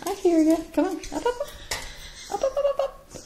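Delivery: very high repeated urging to a bird; high pet coaxing. Real, not acted. Owner urging Beauty onto her finger, very high coaxing. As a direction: chirp the ups way up high.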